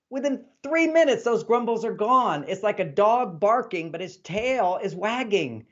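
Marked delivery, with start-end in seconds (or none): none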